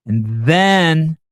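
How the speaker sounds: THEN pitched high, loud and stretched; suspenseful emphasis. Real, not acted. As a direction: draw out "then" loudly to build suspense. Building up a comic routine.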